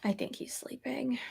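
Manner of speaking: whispered aside; soft, breath-only whisper; hushed. Real, not acted. Concludes that the baby is asleep.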